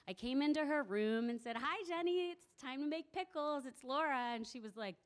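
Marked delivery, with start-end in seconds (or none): 1.6-4.3 high, sing-song hello to a patient; bright cheerful greeting. Retold or imitated. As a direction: announce yourself sweetly to an old friend. Greeting elderly woman brightly.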